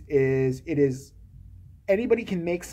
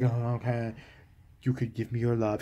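lightly mock delirious wife; 6 semitones lower, 7 dB quieter than usual.

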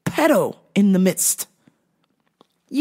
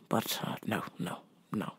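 whispered, much softer denial; 11 semitones lower, 17 dB quieter than usual. Acted. Host whispering the universe's refusal, hushed. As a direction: breathe the no's out in a whisper.

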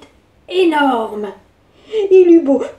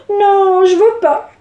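loud, raised refusal; a boy pouting no, pitched up; stubborn boy; 7 semitones higher, 5 dB louder, slower than usual. Acted. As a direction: flatly refuse the soup. Pierre refuses.

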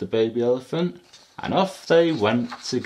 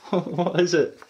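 ordinary delivery

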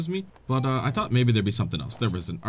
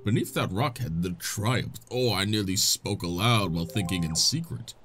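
wistful game character; 4 dB quieter than usual. Acted. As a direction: think aloud like a secretive dreamer. Voices a game character's musing.

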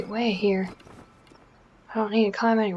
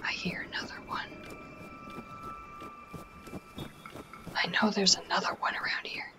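very soft near-whisper; hushed listening; 13 dB quieter than usual. Real, not acted. Player hunting another hidden guard, hushed. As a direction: murmur it while listening out.

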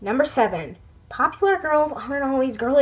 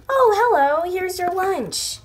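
sugary sweet; 6 semitones higher, slower than usual. Acted. Sweet lunch lady.

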